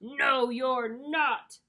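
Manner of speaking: slow, loud troll refusal; storybook troll. A narrator's reading. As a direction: refuse slowly and loudly as a troll. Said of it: "said the troll".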